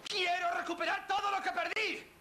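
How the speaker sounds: high furious outburst; angry shout. Acted. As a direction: yell it in rage. Son rages at mother.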